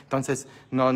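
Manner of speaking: normal talking voice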